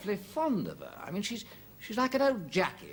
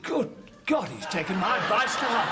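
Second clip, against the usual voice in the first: astonished; 4 dB louder than usual. Acted. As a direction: exclaim at what you have just overheard. Overhears apparent love declarations.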